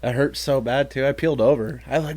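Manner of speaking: ordinary delivery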